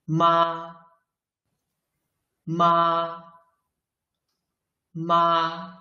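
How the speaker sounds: "妈" held long, high and level; first tone. Shown as a demonstration. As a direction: sing out "妈" on one steady high note. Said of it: "一声".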